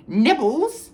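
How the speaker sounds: butter them up, all sugar; sickly sweet. A narrator's reading. Peeves offering fungus peanuts, mock sweet. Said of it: "he said sweetly".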